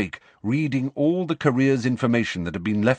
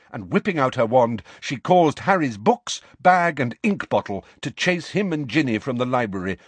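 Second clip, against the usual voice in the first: ordinary delivery, nothing marked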